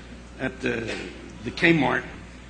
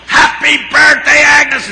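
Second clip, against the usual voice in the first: slow, much higher and louder shout; surprise yell; 13 semitones higher, 11 dB louder, slower than usual. Retold or imitated. Crowd yelling surprise greetings at Agnes at the door. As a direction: shout it like a room full of cheering friends.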